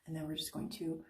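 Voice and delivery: usual speaking voice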